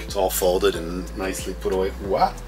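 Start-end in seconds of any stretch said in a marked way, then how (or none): none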